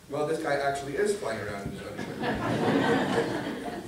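slow, giggling through the words; laughing. Acted. George joking to Harold about his hero, laughing. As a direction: read it with a laugh breaking through. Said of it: "The two boys laughed and laughed".

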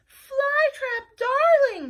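high, loud, adoring call; lovely girlfriend voice. Acted. Wanda greeting Flytrap on the phone, adoring. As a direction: greet him sweetly. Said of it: "said the loveliest voice ever".

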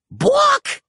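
high, squawking hen cry; chicken squawk. A narrator's reading. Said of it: "said Chickaletta".

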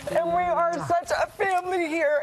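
fighting back tears while thanking everyone; emotional. Real, not acted. Host thanking viewers, tearing up. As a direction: voice rising and cracking with emotion.

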